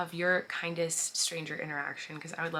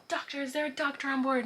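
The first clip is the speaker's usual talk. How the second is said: higher-pitched mock call for help; urgent call out; 9 semitones higher than usual. Flight crew asks the whole plane for a doctor, urgent call. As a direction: imitate crew calling for a doctor.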